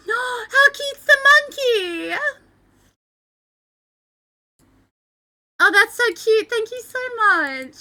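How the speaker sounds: high, drawn-out, choked-up gushing; real, near tears. Real, not acted. Streamer thanking viewers for a cute monkey, near tears. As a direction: gush in a high voice, holding back tears. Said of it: "I'm really trying not to cry".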